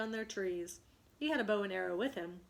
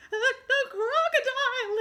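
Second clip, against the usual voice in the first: much higher, loud stammering fright; terrified gasp; 10 dB louder than usual. Acted. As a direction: gasp it out in sudden terror. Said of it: "he gasped".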